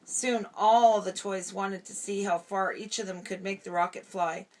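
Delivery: ordinary delivery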